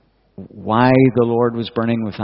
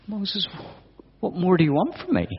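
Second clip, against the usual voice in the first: exasperated God; 8 semitones higher, 6 dB quieter than usual. Acted. God voiced, exasperated at Moses.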